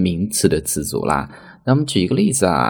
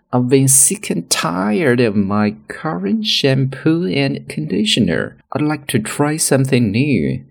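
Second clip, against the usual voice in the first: slow example sentence; 7 semitones higher than usual. Shown as a demonstration. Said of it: "那么我们就会说".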